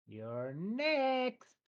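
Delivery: high, gleeful goblin chief voice; high goblin boss. Acted. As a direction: toss it out with cruel glee.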